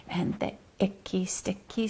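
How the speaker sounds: unvoiced whispered rhyme; whispering. Shown as a demonstration. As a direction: whisper the last line softly. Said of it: "let's use each of these".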